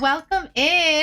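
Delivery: cute anime streamer. Acted. Streamer greeting new viewer.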